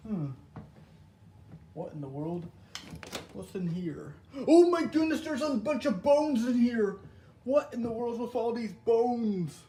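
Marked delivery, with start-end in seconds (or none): 4.5-9.6 high, loud, panicked cry about the bones; horrified. Acted. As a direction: shriek it out in horror. Finds bones in abandoned house.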